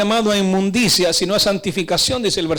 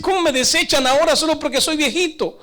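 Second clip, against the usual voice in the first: higher-pitched wounded lament, lightly acted; mock tearful complaint; 7 semitones higher than usual. Old Samuel lamenting his rejection, mock tearful complaint. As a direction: complain like a hurt old prophet. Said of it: "Samuel se pone a llorar y dice".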